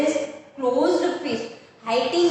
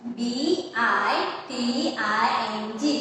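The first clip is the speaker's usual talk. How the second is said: slow spelling; much slower than usual. Shown as a demonstration.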